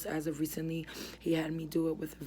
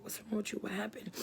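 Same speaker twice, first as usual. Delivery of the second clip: higher, softer worried questions, lightly imitated; alarmed friends; 5 semitones higher, 6 dB quieter, faster than usual. Retelling friends staring as she dropped, mildly imitating their alarm. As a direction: echo the friends' startled concern, a touch high and hushed. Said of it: "my friends were looking at me like".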